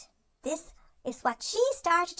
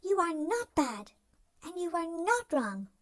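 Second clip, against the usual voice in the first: normal talking voice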